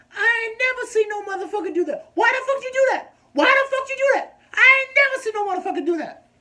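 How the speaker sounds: very high, loud mock-outraged shriek; nurse flipping out. Nurse appalled at what her patient just did, flipping out. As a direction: imitate a brash nurse losing it at full volume. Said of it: "flips out".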